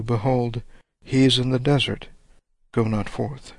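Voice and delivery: usual speaking voice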